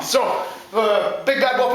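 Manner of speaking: usual speaking voice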